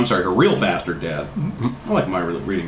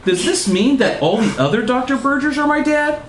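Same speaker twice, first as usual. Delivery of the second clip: put-on high-pitched girl's question; hammed-up questioning, high to the end; puzzled girl reasoning; 14 semitones higher than usual. A narrator's reading. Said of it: "Margaret reasoned".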